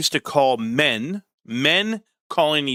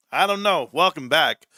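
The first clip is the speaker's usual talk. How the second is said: normal talking voice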